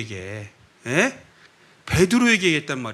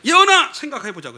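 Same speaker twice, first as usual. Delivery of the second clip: calling out; 7 semitones higher, 5 dB louder than usual. Acted. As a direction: call the child loudly, then ease off. Acted calling out to a child. (아이를 크고 높게 부르기)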